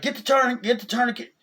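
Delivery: high, loud command; urgent order. Retold or imitated. Doctor urgently orders staff to fetch a tourniquet, loud command. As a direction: bark it at the staff.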